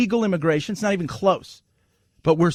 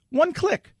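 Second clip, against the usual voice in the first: high rising repeat; 11 semitones higher than usual. Acted.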